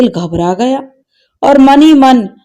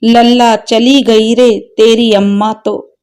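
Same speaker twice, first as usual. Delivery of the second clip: usual speaking voice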